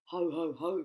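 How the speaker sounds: loud booming ho ho ho; a big gloating laugh; gloating crocodile chuckle. Acted. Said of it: "cried the enormous crocodile".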